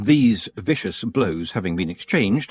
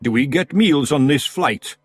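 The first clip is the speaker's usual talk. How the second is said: loud, high Scottish pixie; cheeky wee Scot shouting up; Scottish Feegle; 6 semitones higher, 4 dB louder than usual. Acted. Big Yan.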